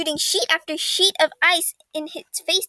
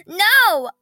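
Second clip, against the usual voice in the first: high, loud desperate cry; screaming; 5 semitones higher, 6 dB louder than usual. Acted. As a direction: scream it in alarm. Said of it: "I screamed".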